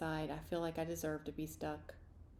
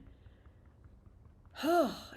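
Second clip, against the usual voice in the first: frustrated sigh; 10 semitones higher, 7 dB louder than usual. Acted. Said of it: "this is frustrating".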